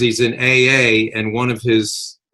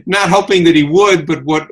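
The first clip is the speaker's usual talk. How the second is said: laughing; 6 semitones higher, 7 dB louder than usual. Real, not acted. Talking through laughter.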